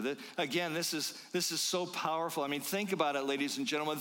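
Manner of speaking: usual speaking voice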